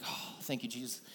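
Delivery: whispered sigh of relief; relieved whisper. Retold or imitated. Tired dad thanking Jesus, soft whispered relief. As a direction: breathe it out softly like a prayer. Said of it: "and I'm like".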